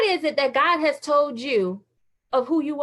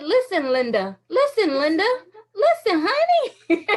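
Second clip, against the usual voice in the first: put-on little boy voice; 6 semitones higher than usual. Speaker quoting a little boy scolding Linda. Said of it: "What that little boy said".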